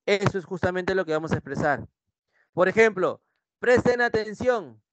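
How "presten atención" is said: high, slow order; modelled command. Shown as a demonstration. Teacher gives an example of an order, commanding tone. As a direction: announce it slowly as a command. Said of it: "Es una orden, es un mandato".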